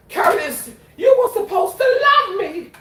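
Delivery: high, loud diva plea; imitating Effie. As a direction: belt it like a wounded diva. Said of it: "quoting Dreamgirls Effie line".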